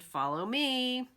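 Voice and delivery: mother duck calling her ducklings; calling follow me. Acted. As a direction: call the brood to follow. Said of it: "Mama Duck called to her brood".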